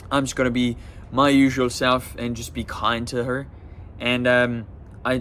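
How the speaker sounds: ordinary delivery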